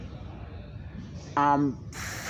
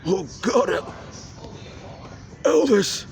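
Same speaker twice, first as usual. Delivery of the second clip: mock alarmed caller; 6 semitones higher than usual. Voiced figure calling to [name].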